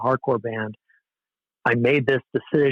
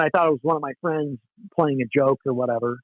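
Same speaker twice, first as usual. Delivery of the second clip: normal talking voice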